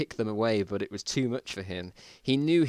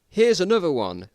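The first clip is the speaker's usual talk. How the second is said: loud, raised call to the others; shouting; 8 semitones higher, 8 dB louder than usual. Acted. Survivor shouts to Mike about another one. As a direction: shout it across the room. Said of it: "then shouted".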